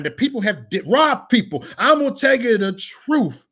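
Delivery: high-pitched, slow, emphatic preacher's delivery; preaching. Acted. Preacher pleading for people in prison, high and slow. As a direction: preach it high, drawing out the key words.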